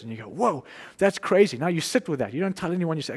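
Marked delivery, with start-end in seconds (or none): 0.3-1.6 amazed whoa. Retold or imitated. Hearing God's call, amazed.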